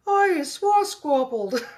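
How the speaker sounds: very high, loud, slow bellow; giant's bellow. A narrator's reading. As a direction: bellow it out, hammed-up. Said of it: "bellowed the bone cruncher".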